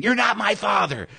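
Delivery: high-pitched screaming held down in volume; imitated hysterical yelling, not full volume; mock scream. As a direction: act out someone screaming in a high, strained voice. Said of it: "she eventually would be screaming".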